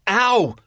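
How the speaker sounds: sudden high, loud yelp of pain; cry of pain. A narrator's reading. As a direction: yelp as you hit the floor. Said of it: "Doctor Probst cries out".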